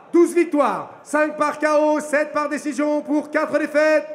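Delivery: ordinary delivery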